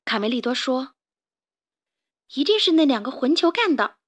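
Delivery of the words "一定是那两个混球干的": a touch of indignation, louder and higher; mildly angry accusation; indignant. Acted. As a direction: Carmen blaming the two rascals, mildly indignant. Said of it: "卡门愤愤地说".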